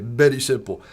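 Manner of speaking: put-on Spanish-accented mentor voice; Spanish-accented, said with conviction; Jose Santana's Spanish accent. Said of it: "He actually said it".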